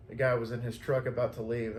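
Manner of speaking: ordinary talk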